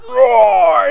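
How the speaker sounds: loud, long growling roar; bear roar. Acted. Mister Bear roars at the bees, bear roar. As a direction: let the word become a big roar. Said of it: "Mister Bear turned again and said".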